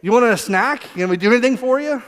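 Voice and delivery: put-on high, eager kid asking to help; lively imitation of ingratiating kids; wheedling kids. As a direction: chirp it like children buttering up a parent. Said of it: "this is my kids coming to me".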